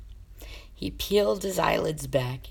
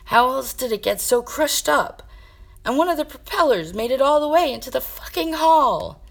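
much higher, louder accusing shout; angry yell; 10 semitones higher, 9 dB louder than usual. Acted. Jaden yelling at Sasha about the smashed plane, loud and angry. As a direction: rage about the broken plane.

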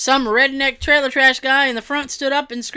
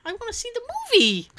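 whiny complaint; 11 semitones higher, 3 dB quieter, faster than usual. Retold or imitated. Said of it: "It's like".

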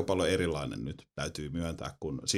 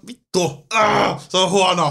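high, loud burst of exasperated fury; raging outburst; 10 semitones higher, 9 dB louder, much slower than usual. Acted. Gamer venting about bad game design, high and loud rage. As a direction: let out a frustrated roar over the game. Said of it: "Mä säästän mun raivon".

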